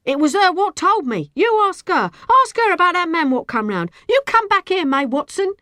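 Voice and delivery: high, loud clamour; heated shouting after a witness; indignant appeal. A narrator's reading. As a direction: call on the crowd to believe you. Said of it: "She appealed to the crowd".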